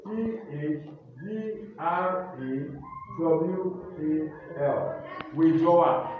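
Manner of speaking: loud, slow classroom dictation voice; spelling dictation. Acted. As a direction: call each word and letter out to the class. Teacher dictating spelling words.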